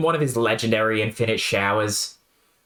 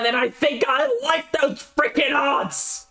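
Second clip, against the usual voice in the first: higher, shouted with full defiance; defiant shout; 13 semitones higher, slower than usual. Acted. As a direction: throw it out as a triumphant yell. Jake shouting defiance at robot.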